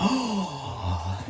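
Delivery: imitated drawn-out high "oh" of a shocked crowd; crowd gasp. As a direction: mimic a crowd's collective gasp. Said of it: "lets out this huge gasp".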